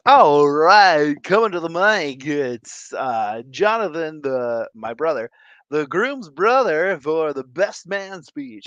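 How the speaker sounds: loud, mock DJ announcing on the mic; lightly voiced party DJ; DJ announcer. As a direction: hype it up like a wedding DJ. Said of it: "the D J goes".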